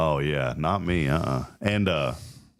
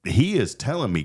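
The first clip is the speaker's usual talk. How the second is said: ordinary delivery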